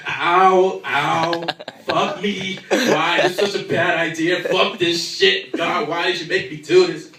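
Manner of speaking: loud pained cries and curses; pained, exasperated Jesus; Jesus on the cross. Acted. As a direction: yell it like a man nailed up, hurting. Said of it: "some of the things that he probably said".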